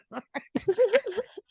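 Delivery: high-pitched giggling laugh; high giggle. Real, not acted. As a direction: burst into a squealing giggle. Laughing at her impressions.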